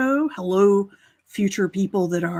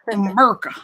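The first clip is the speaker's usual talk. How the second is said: Southern accent; 6 dB louder, much faster than usual. Shown as a demonstration. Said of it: "I'm not making fun of the Southern accent".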